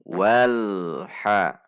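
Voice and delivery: loud "wal" with a steady l leading straight into a short "ha"; correct joined lam. Shown as a demonstration. Teacher models the right reading, l joined to ha. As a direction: recite "wal ha", l neither bounced nor dragged. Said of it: "Antara lam sukun dan ha tidak terpisah".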